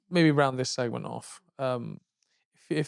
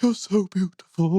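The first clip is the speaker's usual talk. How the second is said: adoring stalker; 3 semitones higher than usual. Retold or imitated. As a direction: draw it out like a lovestruck fan. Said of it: "he just starts going".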